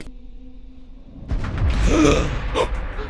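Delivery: a quick, high intake of breath; sharp startled gasp. Real, not acted. Reacting to the doll appearing, a startled gasp. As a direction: gasp as the scare hits. Sudden scare in the video.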